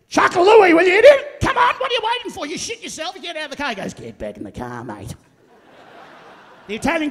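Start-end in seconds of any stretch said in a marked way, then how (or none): 0.1-3.7 high, loud Aussie tirade, easing off at the end; impatient satnav voice yelling abuse, pitched way up; Ken. Acted. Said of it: "Ken, sounds like this". 3.9-5.1 very low, softer Aussie voice; gruff order. Acted. Second voice in navigation skit.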